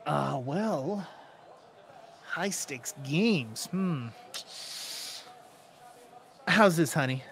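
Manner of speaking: lightly voiced sleazy gambler, hesitant; a hint of an oily come-on; flirting gambler. Game master plays flirting gambler.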